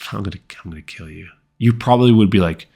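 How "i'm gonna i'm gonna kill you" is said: very soft, quick throwaway murmur; creepy, casual understatement; smiling threat. Shown as a demonstration. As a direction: toss it off quietly with a smile. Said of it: "if they just smiled".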